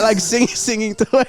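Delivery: ordinary talk, nothing marked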